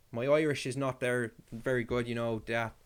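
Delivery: badly faked Irish brogue; bad Irish accent. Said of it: "I can't do an Irish accent".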